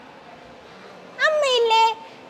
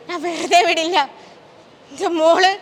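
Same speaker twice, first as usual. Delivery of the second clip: ordinary talk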